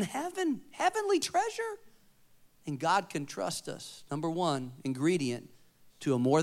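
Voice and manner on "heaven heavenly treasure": soaring high. Real, not acted. Preacher lifts his voice high.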